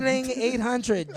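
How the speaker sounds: high, ringing machine rattle then a score; punching machine imitation. Comic mimicking a punching machine during his set, high and loud. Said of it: "hitting the punching machine".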